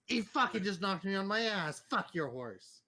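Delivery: a touch of outraged complaint; indignant outburst. Acted. Knocked over by a horse and protesting, indignant. Acted quarrel after being knocked down.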